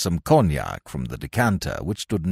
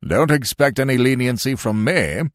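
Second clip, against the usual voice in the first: higher, blustering colonel; cried out; 6 semitones higher, 4 dB louder than usual. A narrator's reading. Colonel refusing the warder leniency, crying out. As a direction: cut him off with an indignant cry. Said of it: "cried the colonel".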